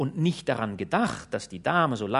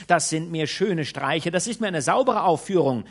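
loud, rising indignant tirade; indignant scolding; 4 semitones higher, 6 dB louder than usual. A narrator's reading. Old maid scolding her master for bringing in the lady, loud scolding. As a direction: scold him and quit.

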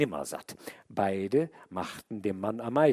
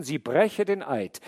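plain reading voice

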